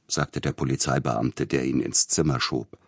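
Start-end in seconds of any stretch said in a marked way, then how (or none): none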